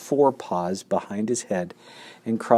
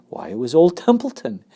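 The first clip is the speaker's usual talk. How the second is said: ordinary delivery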